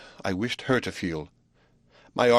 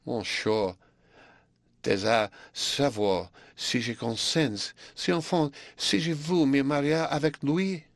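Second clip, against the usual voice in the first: put-on higher, coy girl's voice in French; shy young French pupil; 5 semitones higher than usual. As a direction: play the bashful pupil answering her master.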